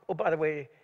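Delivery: usual speaking voice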